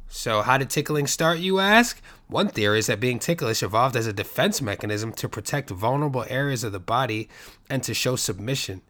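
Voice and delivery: ordinary talk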